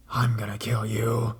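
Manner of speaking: slow, breathy whispered creep; creepy whisper. Retold or imitated. Caller whispering to the operator Mel, slow creepy whisper. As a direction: whisper it slowly into the phone. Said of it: "almost like a whisper".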